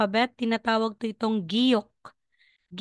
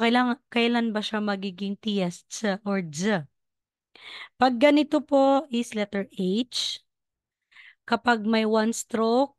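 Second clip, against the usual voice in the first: ordinary talk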